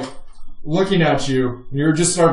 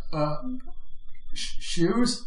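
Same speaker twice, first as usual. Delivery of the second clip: halting, softer, baffled question; shocked stammer; 3 semitones higher, 7 dB quieter, slower than usual. Acted. Stunned officer asked about his shoes, shocked stammer. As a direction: stumble on the word, rising in disbelief. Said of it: "He is in shock".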